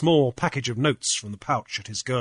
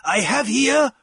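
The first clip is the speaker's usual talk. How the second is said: high, loud public declaration; announcing; 12 semitones higher, 5 dB louder than usual. A narrator's reading. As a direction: announce it to the assembly. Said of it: "Jeremiah announced".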